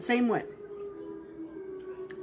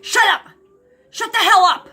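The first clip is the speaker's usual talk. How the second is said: loud, high shout to silence a dog; retold angry yell; 10 semitones higher, 9 dB louder than usual. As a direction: yell it in sharp bursts, acting out his command. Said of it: "he claps his hands together".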